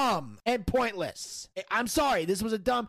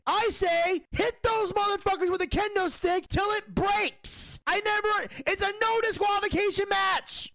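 angry rant; 8 semitones higher than usual. Real, not acted. Continuing rant.

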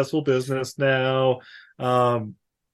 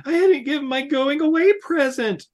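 much higher, lightly put-on girl's voice; 11 semitones higher than usual. Ella calling after the old man, higher girlish voice. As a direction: chirp it like a young girl calling. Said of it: "she's like".

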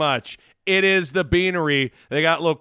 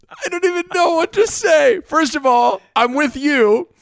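high, loud, worked-up disbelief; raised, high-pitched exasperation; amused disbelief; 8 semitones higher, 5 dB louder than usual. Real, not acted. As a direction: protest loudly at the top of your voice. Exasperated amusement at own replayed outburst.

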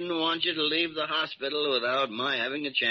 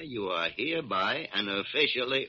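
ordinary talk, nothing marked

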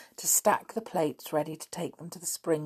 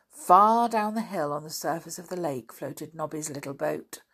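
plain reading voice

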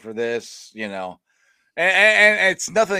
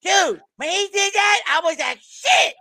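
high, loud cartoonish character voice; movie kid impression; 11 semitones higher, 6 dB louder than usual. Retelling a Weird Science scene, high cartoon voice. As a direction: squeeze it high, push it loud. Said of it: "that is, that is Cartman".

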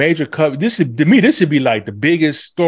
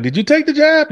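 pointed reporter question. Retold or imitated. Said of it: "they were like".

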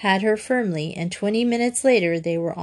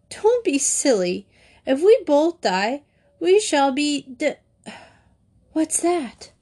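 put-on startled child; 7 semitones higher than usual. A narrator's reading. Joey hears a call.

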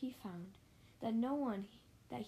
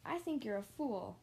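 ordinary delivery, nothing marked

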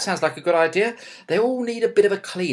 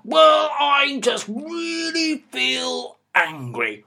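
slow, raised voice in put-on anger; hammed-up angry dad, drawn out; slow mock angry shout; 7 semitones higher, much slower than usual. Shown as a demonstration. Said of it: "showing you that I can raise my voice".

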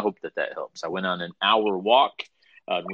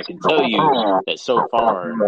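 demonic voice; 3 semitones higher, 8 dB louder than usual. Real, not acted. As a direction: voice glitching like possessed. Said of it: "there's that demonic voice again".

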